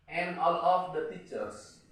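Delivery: slow "And all of the teachers" with "en ol of de ticers"; careful dictation for the class. Shown as a demonstration.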